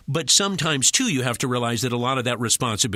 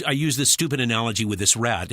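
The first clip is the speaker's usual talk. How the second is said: ordinary talk, nothing marked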